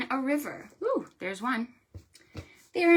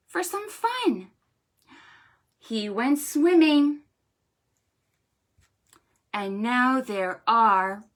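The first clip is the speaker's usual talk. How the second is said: slow, singsong rhyme with big high leaps; storytime rhyme; 4 semitones higher, 6 dB louder than usual. Shown as a demonstration. Librarian reciting a counting rhyme to children. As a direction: read it like a picture-book rhyme.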